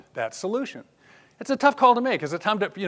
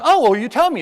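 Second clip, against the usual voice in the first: challenging retort; 7 semitones higher, 9 dB louder than usual. Retold or imitated. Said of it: "And she goes".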